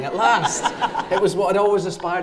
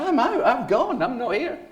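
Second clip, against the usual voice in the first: high, bouncy, triumphant goodbye; gleeful announcement; 10 semitones higher than usual. Retold or imitated. Telling his mate he has left school, gleeful. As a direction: boast that you're free at last.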